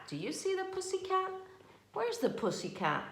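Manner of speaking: high, fast playful question to a little one; sing-song question. Real, not acted. Mom asking her toddler to spot the cat, high and playful.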